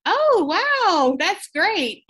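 high, loud surprised "oh wow". Retold or imitated. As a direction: burst out with sudden surprise. Surprised reply to doctor's news.

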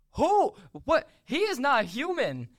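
high, indignant squeal; real comic outrage; incredulous protest. Real, not acted. Outraged disbelief at script's claim.

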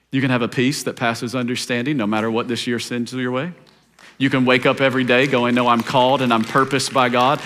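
hushed, gentle preaching; intimate sermon. Acted.

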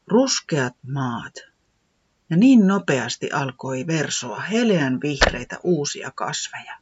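ordinary delivery

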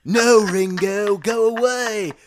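bossy Liverpool voice; Beatle impression. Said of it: "This is what all the [name] sound like".

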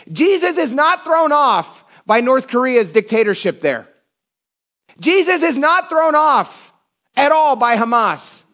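high, slow, drawn-out proclamation; emphatic preaching. Real, not acted. Preacher assures church God is never thrown off, impassioned proclamation.

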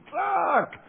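sudden shout. Retold or imitated. Teller mimics the lawyer's shouting.

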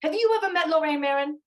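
high, insistent imitation of a tough detective; in-his-face challenge. New York detective confronting a ten-year-old, high and forceful. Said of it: "She kinda got in his face".

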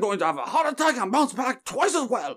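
high-pitched, badly missed politician accent; hammed-up stump speech that drifts toward Dracula; Bernie Sanders impression. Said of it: "he's trying to do a Bernie accent".

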